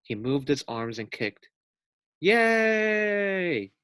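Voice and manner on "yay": high, loud cheer; fans cheering. Acted. Fans calling out to the swimming pig, cheering high. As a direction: cheer like a happy crowd. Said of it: "called the fans".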